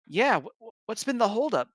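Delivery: high, stammering complaint; crying out. Acted. Oceania shivering at the gate, crying out in annoyance. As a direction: push it up high, annoyed and stuttering. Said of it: "she cried".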